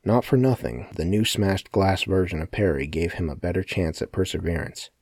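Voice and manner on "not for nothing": ordinary delivery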